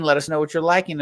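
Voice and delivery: ordinary talk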